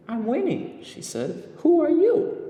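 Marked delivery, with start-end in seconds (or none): 0.1-0.8 put-on much higher young girl voice; bright-eyed little girl introducing herself; girl character voice. A narrator's reading. 1.6-2.4 put-on very high, loud, eager little girl; excited young girl calling out a question; girl character voice. A narrator's reading. Girl asks boy.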